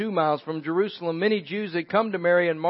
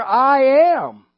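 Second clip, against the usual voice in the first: proclaiming; 6 semitones higher, 8 dB louder than usual. Acted. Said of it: "But Jesus said to her".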